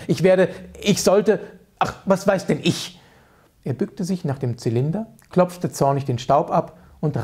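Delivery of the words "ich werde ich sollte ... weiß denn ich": exasperated sputter. Acted. Hoffmann fumes, exasperated.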